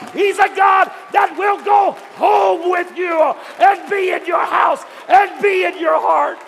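loud, high climactic preaching; preacher roaring the climax to the church; full-force preaching. Real, not acted. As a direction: drive it home to the congregation.

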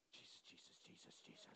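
frantic whispered Jesus; terrified whispering; whispered prayer. Retold or imitated.

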